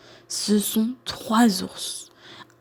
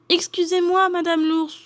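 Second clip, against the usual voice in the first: put-on apologetic girl; 9 semitones higher than usual. A narrator's reading.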